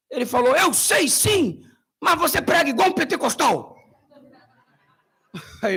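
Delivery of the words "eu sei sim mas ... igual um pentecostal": mock pastor reply. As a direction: answer like the knowing host pastor. Other pastor's reply imitated.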